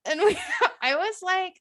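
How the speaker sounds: usual speaking voice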